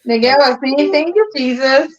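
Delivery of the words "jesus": usual speaking voice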